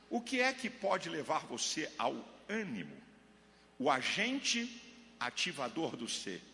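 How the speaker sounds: usual speaking voice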